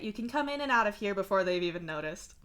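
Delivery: usual speaking voice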